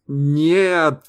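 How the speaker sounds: high, loud panicked shout; yelled. A narrator's reading. Сейга yells at the men to stop them. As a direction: yell it out, desperate. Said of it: "заорал Сейга".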